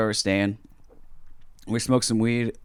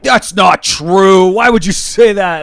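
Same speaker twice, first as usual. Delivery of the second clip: loud, high, slow mock outrage; indignant protest; 8 semitones higher, 11 dB louder, much slower than usual. Officers objecting to his remark about San Diego cops, indignant. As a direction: act out offended officers, stretching the words.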